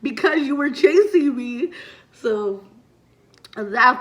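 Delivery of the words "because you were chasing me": mock sassy answer. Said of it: "And he was like".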